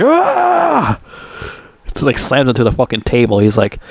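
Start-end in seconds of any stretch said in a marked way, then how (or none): 0.0-1.0 high-pitched, drawn-out attack yell; imitated yell. Retelling Rambo grabbing Murdoch, imitated yell. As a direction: mimic a scream while grabbing someone. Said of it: "Rambo just grabs him, like".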